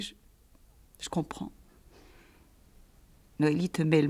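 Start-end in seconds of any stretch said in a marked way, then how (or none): 0.0-1.5 « je comprends » répété dans un souffle chuchoté; répéter sans voix, anéantie. A narrator's reading. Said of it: "J'ai répété". (« je comprends » chuchoté, presque inaudible)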